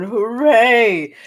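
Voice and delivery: loud, high hooray; big mock-triumphant shout; drunken cheer. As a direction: cheer as if you won. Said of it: "In Spear's mind, he's going".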